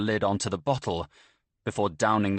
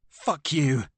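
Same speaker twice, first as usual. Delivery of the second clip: higher, spat out in hatred; a bitter, higher snarl of contempt; spat curse; 6 semitones higher than usual. A narrator's reading. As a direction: spit the words at him. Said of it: "she spits".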